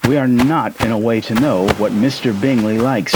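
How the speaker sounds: ordinary delivery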